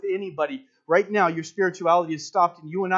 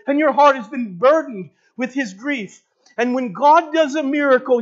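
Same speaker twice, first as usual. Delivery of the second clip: raised, loud pulpit preaching; preaching at full force; 6 semitones higher, 6 dB louder than usual. Acted. Preacher stirring his church, loud and raised. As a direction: drive it home to the congregation.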